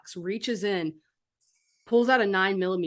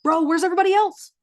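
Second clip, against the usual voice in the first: high, loud, urgent question; urgent calling out; 10 semitones higher, 9 dB louder, faster than usual. Acted. Lookout looking for the rest of the group, urgent. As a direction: call it out in alarm.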